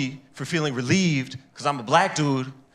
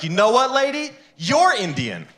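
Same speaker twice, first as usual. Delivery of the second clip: higher, punchy comeback voice; mock sassy retort; 10 semitones higher, 4 dB louder than usual. Comic retorting to the woman, a higher sassy comeback. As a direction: fire back like a final insult. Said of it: "I was like".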